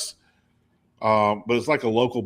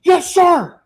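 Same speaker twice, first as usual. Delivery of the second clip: high, loud military yes sir; snappy little kid; 11 dB louder than usual. Retold or imitated. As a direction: snap to attention like a cadet. Kid snaps to attention.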